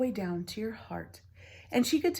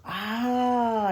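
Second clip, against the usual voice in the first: excited; 4 semitones higher, 8 dB louder than usual. A narrator's reading. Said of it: "exclaimed the sister in excitement".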